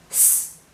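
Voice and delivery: just the hissed consonant "S", no vowel; soft, sustained "sss"; soft S sound. Shown as a demonstration. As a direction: make the S sound, not the letter name. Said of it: "that soft S sound".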